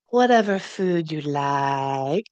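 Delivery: slow, meek, eager-to-please bride; docile bride. Retold or imitated. Bride answering the groom's questions, slow and meek. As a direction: drag it out sweetly, giving in to everything. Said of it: "And he goes".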